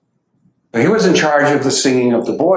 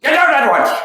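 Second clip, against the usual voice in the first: Adi's booming order to leave; commanding roar; 14 semitones higher than usual. Retold or imitated. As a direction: thunder it like a lion ordering them out. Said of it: "in his Adi K Rani voice said".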